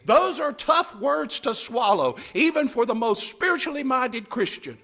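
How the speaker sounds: usual speaking voice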